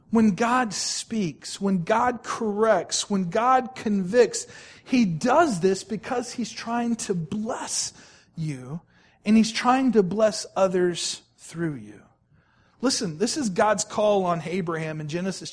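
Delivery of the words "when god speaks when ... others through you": slow, weighty preaching cadence; slow and deliberate. Acted. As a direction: measure out each phrase slowly. Slow solemn sermon point.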